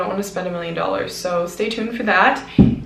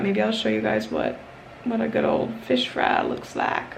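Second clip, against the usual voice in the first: put-on southern accent; 5 dB quieter than usual. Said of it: "I did that in a southern accent".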